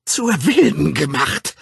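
slow, high, loud screech of rage; wütendes Schreien. A narrator's reading. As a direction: scream it out word by word. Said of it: "schrie sie". (langsam und zornig herausschreien)

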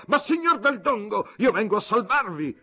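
grido molto acuto e forte; grido terrorizzato. Acted. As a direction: strilla spaventato per salvarsi. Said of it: "terrorizzato, gridò". (il generale terrorizzato grida a Fabrizio)

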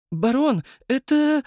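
high, breathless excited start; bursting to share news. Acted.

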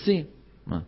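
ordinary talk, nothing marked

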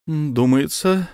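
slow, careful opening word; cautious start. Acted. Officer begins cautiously to the angry count, slow and careful. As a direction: tread carefully. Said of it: "осторожно начал".

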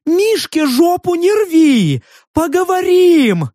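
high, wailing shout; panicked plea. A narrator's reading. Beast-faced stranger begs to talk, panicked.

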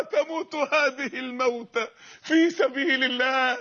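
slow, choked-up classical Arabic; slow tearful quote. Acted. Captive wishing for a hundred lives, slow and tearful. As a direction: let tears rise as you say it. Preacher tells audience to feel it.

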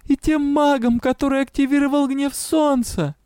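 high shouted oath; shouting. A narrator's reading. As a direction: cry it out. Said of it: "выкрикнула я".

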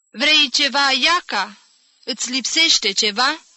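loud, high questions for a deaf ear. A narrator's reading. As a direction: call out the questions to an old woman. Jim calling to the deaf old woman.

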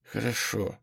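soft, resigned murmur. A narrator's reading. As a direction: give in, barely audible. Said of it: "сказала она ещё тише".

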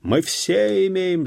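high, loud, drawn-out cry; crowd shout. A narrator's reading. Peasants interrupt the interrogation, shouting. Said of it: "закричали мужики".

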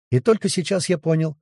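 ordinary delivery, nothing marked

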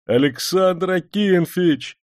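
high, slow, glad shout of a name; overjoyed servant greeting; joyful cry. A narrator's reading. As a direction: call out the name with delighted surprise. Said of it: "обрадованно вскрикнул слуга".

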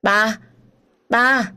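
put-on high, loud child calling for daddy; a toddler wailing for her father; a child crying out for daddy in a high voice; crying little girl. A narrator's reading. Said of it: "nghêu ngao khóc".

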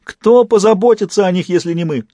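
hammed-up despairing howl; wailing. A narrator's reading. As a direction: wail over everything you are losing. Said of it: "шлёпнулась на пол и завыла".

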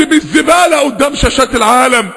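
ordinary talk, nothing marked

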